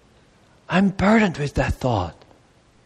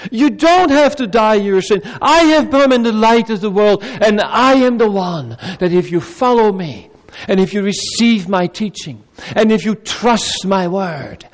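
louder, insistent preaching build; fervent preaching; 4 semitones higher, 3 dB louder than usual. Acted. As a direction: drive the promise home with force. Preacher building to full force.

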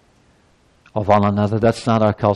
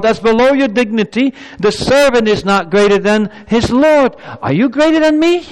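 higher and louder fervent sermon voice; preaching at full force; 10 semitones higher, 4 dB louder than usual. Acted. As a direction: preach it with rising fire.

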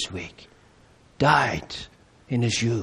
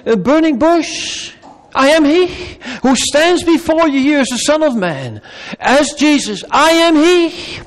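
climactic preaching; 7 semitones higher, 4 dB louder than usual. Acted. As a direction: build to a thunderous declaration.

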